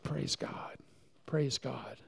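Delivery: soft whispered thanks; whispered praise. Retold or imitated. Preacher recalling his grateful reply to his daughter, whispered. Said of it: "And I said".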